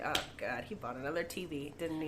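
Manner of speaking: low, soft aside of mock dread; weary private thought, acted out under the voice; resigned inner groan. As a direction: mutter it to yourself, dropping low and quiet. Retelling her dreading inner thought.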